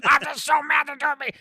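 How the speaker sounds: high, loud mock shout of rage; furious Nick Nolte. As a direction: Nolte blowing his top. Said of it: "I'm Nick Nolte".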